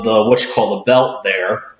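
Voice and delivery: normal talking voice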